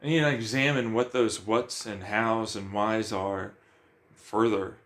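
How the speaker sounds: usual speaking voice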